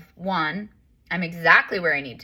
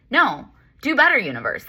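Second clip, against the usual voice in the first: exasperated; 6 semitones higher than usual. Retold or imitated. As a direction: scold the universe, exasperated. Fed up, scolding the universe.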